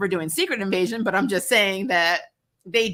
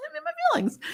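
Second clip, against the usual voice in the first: laughing; 3 dB quieter, much faster than usual. Real, not acted.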